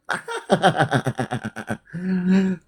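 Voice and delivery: long, staccato cackle; soft rattling laughter. Real, not acted. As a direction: laugh in a run of quick, soft bursts. Laughs after joke about bodyguard.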